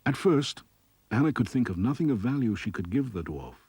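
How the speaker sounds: ordinary delivery, nothing marked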